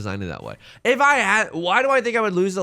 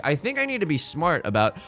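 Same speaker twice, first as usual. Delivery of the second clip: ordinary talk, nothing marked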